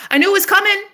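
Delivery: very high-pitched triumphant cry; exultant. Real, not acted. Woman celebrating what she manifested, exultant crowing. Second, escalated triumphant repeat.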